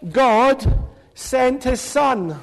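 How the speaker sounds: "God sent his son" drawn out word by word; slow and deliberate. Shown as a demonstration. Leader teaching children, slow and deliberate.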